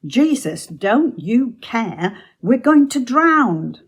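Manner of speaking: high, loud cry for help; retold panicked shout. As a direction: shout it as a desperate disciple. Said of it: "they shouted to him".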